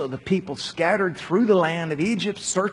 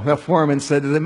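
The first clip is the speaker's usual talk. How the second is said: ordinary delivery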